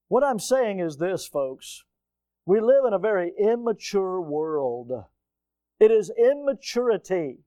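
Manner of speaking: ordinary talk, nothing marked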